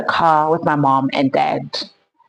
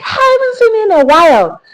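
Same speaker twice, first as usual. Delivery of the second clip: high, louder, put-on delighted hello; mock gushing greeting; 5 dB louder than usual. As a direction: parody a too-sweet reunion. Mocking mom and guy's greeting.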